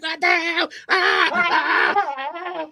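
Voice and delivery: high, shocked cry of someone sprayed; panicked yelp. Retold or imitated. Customer hit by spray, panicked high yelping. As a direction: shriek like you just got maced.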